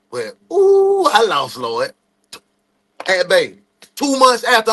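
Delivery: ordinary delivery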